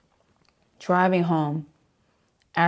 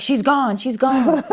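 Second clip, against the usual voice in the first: high, louder nurse voice with laughter creeping in; hammed-up nurse, pitched way up; imitated alarmed nurse; 9 semitones higher, 5 dB louder, much slower than usual. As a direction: mimic a nurse declaring the patient lost. Imitates nurse about to sedate her.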